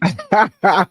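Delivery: bright burst of laughter; loud laugh. Real, not acted. Host greets guest warmly.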